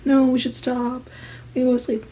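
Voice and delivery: hushed imitation of someone resisting; mimicked half-hearted protest. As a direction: recite the overheard protest softly, in a singsong. Same protest mimicked again.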